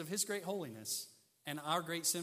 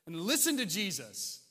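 loud, emphatic mock rebuke; Father interrupting; 5 semitones higher, 7 dB louder than usual. The Father silencing a talkative disciple, firm rebuke. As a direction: cut him off with slow, weighty force. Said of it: "The Father interrupts him".

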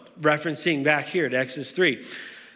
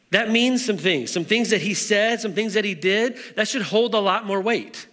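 rising higher and more urgent preaching; building sermon climax; 4 semitones higher than usual. Acted. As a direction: drive the point home, building pitch.

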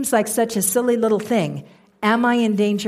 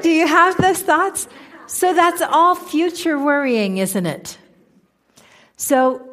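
ordinary delivery

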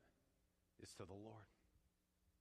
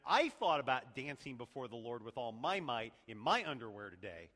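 normal talking voice

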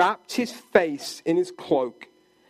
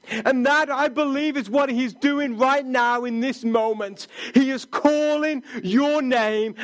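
rising sermon appeal; 6 semitones higher than usual. Acted. Preacher urging listeners that Jesus is calling their name, building fervour. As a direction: press the appeal home to the congregation.

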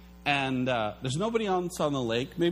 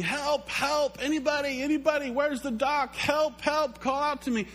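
much higher desperate calls for help; panicked cries; 10 semitones higher than usual. Pretend. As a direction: cry out like someone drowning in fog. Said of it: "You're screaming".

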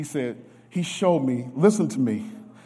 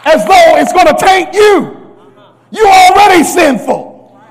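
much higher and louder, half shouted; shouted preaching; 12 semitones higher, 14 dB louder than usual. Real, not acted. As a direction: preach it to the rafters.

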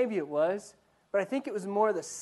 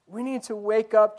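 ordinary talk, nothing marked

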